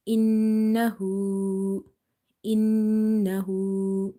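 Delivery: innahu very slow with held nasal nn; innahu, stretched nasal nn; nasal ghunna. Shown as a demonstration. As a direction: hum the nn of innahu for one alif.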